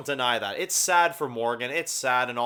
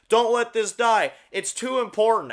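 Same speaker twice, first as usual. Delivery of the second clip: higher, louder mock-earnest last words; mock dying speech; 6 semitones higher, 4 dB louder than usual. Mocking the dying father's calm speech, hammed-up earnest. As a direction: ham up a noble farewell. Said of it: "giving a fucking philosophical, like, type speech".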